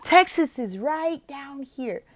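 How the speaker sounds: high, loud, slow delight; excited pointing. Shown as a demonstration. Tutor excitedly shows Oklahoma on the map. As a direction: show the map with enthusiasm. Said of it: "I'd be like".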